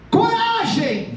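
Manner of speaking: high, drawn-out rallying cry; long ringing yell; shouted command. Acted. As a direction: call it out across the water. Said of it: "Ele grita essa palavra".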